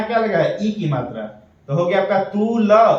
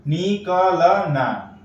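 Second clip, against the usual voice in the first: निकलना stretched slowly into नि-क-ल-ना; slow syllable blending; much slower than usual. Shown as a demonstration. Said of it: "लेकिन हम लोग क्या पढ़ेंगे?". (निकलना को धीरे-धीरे अक्षर जोड़कर)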